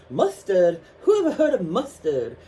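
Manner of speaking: high, loud indignant Hatter with a lisp; imitating Mad Hatter. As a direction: scoff at the mustard like the Hatter.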